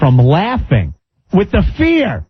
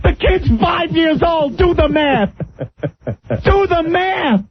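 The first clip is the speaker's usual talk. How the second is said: incredulous high outburst; 11 semitones higher than usual. Real, not acted. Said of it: "I'm roaring".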